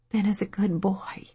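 weak, whispered plea; limp weak voice. A narrator's reading. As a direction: let it come out limp and whispered. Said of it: "hated how limp and weak her voice was".

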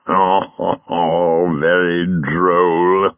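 slow, drawn-out amused chuckle; chuckling. Acted. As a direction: savour it slowly through a chuckle. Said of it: "Jago chuckled".

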